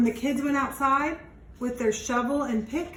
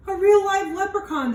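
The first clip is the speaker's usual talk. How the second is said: high, loud, thrilled cry; excited discovery; 10 semitones higher, 8 dB louder than usual. Acted. Children spotting the leprechaun in the trap, excited cry. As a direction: gasp it out like a child finding treasure.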